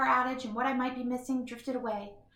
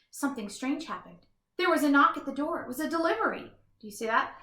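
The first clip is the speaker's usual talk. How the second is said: usual speaking voice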